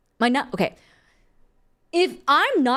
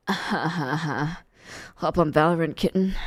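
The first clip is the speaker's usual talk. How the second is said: mock fuckboy; 7 semitones lower, much slower than usual.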